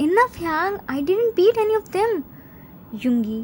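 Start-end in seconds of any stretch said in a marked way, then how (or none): 0.1-2.1 high, mildly raised shout; acted exasperated outburst. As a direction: protest firmly in frustration. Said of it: "June shouts".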